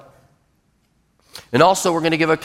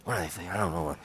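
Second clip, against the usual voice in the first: very soft, low mumble to oneself; muttering; 12 semitones lower, 8 dB quieter than usual. Shown as a demonstration. Worker grumbling to himself while walking away, muttering. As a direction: mutter broken phrases under your breath. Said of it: "that's muttering".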